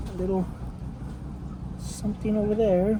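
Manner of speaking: lightly whispered, hushed painter musing; a hint of a gentle TV painter admiring his canvas; Bob Ross. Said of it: "I feel like Bob Ross".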